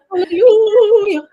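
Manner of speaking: ecstatic hallelujah. Real, not acted. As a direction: cry it out in joy. Speaker echoes the cheer.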